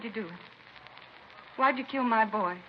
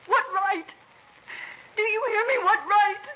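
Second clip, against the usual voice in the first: much higher and louder, near a scream; anguished outburst; 7 semitones higher, 4 dB louder than usual. Acted. Mother confronting her son's killer, anguished shout. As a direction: demand it through grief, breaking.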